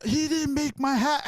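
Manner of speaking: high, slow mock whine with a laugh; hammed-up high grievance, drawn out; imitated complaining grandpa. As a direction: mimic an old man's pitiful complaint.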